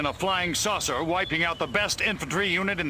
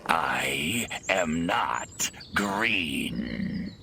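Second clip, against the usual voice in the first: very low, very slow alien drone; alien correction; 12 semitones lower, much slower than usual. Acted. Alien corrects the screaming woman, low and slow. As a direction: drawl a deadpan objection.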